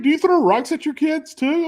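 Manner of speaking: high, loud sarcastic jab; incredulous mock. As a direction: needle the dad in disbelief. Mocking self quote.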